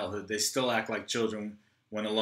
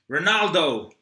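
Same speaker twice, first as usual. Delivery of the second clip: higher, louder shout of the name; angry shout; 6 semitones higher, 8 dB louder, slower than usual. Acted. Troy shouts at Rocky on the call, angry. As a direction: bark the name down the phone.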